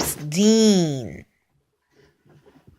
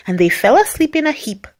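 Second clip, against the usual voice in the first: ordinary delivery, nothing marked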